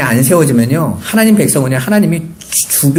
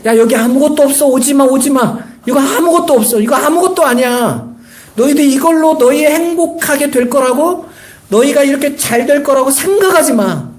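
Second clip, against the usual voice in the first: retold calling out; 11 semitones higher than usual. Preacher voicing Solomon's warning to those behind, high-pitched calling out. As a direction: call back a warning, pitched far up. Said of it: "뒤에 외치는 거예요".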